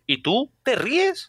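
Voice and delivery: high, very slow, disbelieving; incredulous retort. Retold or imitated. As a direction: drag it out in mock disbelief. Retorting to Will Smith. (réplica incrédula, aguda y muy lenta)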